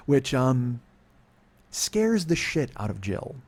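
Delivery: ordinary talk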